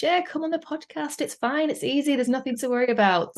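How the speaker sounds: high-pitched, upbeat mock reassurance; breezy coaxing of colleagues. Podcast producer talking colleagues into appearing, breezy high-pitched coaxing. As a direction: coax them lightly, as if it's nothing. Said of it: "hypocritical of me to say to all of our colleagues".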